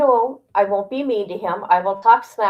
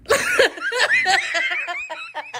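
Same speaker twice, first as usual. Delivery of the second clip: high giggling laugh. Real, not acted. Filming her angry dad, laughing hard. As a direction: burst into high shrill giggles.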